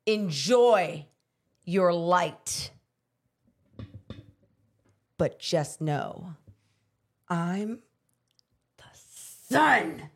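slow heel promo, whispered end; smirking villain promo, drawn out; wrestling heel promo. Pretend. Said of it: "try to do a wrestling promo".

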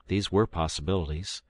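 ordinary delivery, nothing marked

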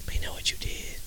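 breathy conspiratorial whisper; sly whisper. Acted. Host whispering a knowing jab at Kim. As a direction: lean in and whisper it like a secret.

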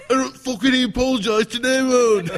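loud, high mock Irish shout; hammed-up Irish accent sliding into Liverpool; Conor McGregor. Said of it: "listen to Conor McGregor talk".

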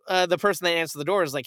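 ordinary talk, nothing marked

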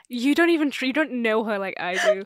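loud, hammed-up protest in defence of a friend; mock indignant wife. Wife defends her ghost friend to John, mock indignant.

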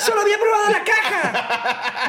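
squeaky wonder at real cereal; hammed-up poor kid tasting cereal; amazed kid. Acted boy about cereal.

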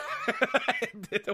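big burst of laughter. Real, not acted. Reacting to the story.

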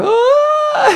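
very high-pitched imitation scream; Daniel Stern scream. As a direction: shriek like a horror-film victim, played for laughs. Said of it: "who doesn't love the Daniel Stern scream".

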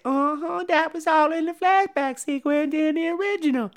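high put-on young woman's voice; high girlish voice. Danielle Harris's character asks Tony Todd, high girlish. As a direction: ask it wide-eyed in a high voice.

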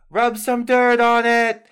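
Una's blunt voice. Acted. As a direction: bark it like a tough medic. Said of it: "And she goes".

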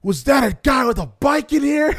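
put-on high, loud, outraged old-man shout; spazzing old man. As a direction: shriek like a scandalized customer. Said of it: "this one old dude just starts spazzing".

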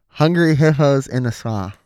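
talking with a mouthguard in; words blurred by a mouthguard. Real, not acted. Putting the thing back in.

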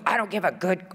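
high mock-furious retort; retold angry dad. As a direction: imitate a father cutting off the plea in anger. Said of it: "he was just really mad".

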